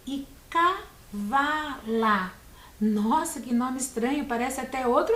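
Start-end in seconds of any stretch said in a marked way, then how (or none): 0.5-2.3 cavala split as CA-VA-LA, slowly; syllable by syllable. Shown as a demonstration. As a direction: count out cavala with pauses between syllables. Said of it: "três sílabas". (cavala separado em três sílabas, com pausas)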